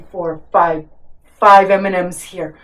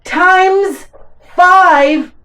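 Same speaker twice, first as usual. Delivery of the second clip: slow and high, stretching each word; a slow, high, excited reveal; drawn out excitedly; 8 semitones higher, slower than usual. Real, not acted. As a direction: spell the answer out slowly in triumph. Child drawing out the answer.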